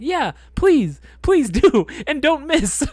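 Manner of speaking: high, loud pleading with laughter; retold anxious plea. Nervous recruit to the blood-draw staff, high pleading. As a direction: beg them, half laughing.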